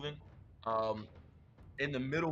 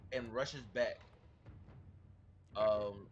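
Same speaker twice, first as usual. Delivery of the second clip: usual speaking voice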